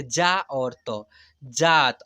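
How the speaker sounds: जा, then त, then जात with a long loud आ; sounding-out demo. Shown as a demonstration. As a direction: break जात into parts, then join it. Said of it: "तो इसे पढ़ेंगे". (जा और त जोड़कर जात, आ खींचकर)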